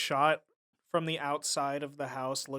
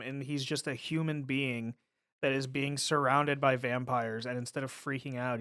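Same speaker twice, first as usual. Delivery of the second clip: ordinary talk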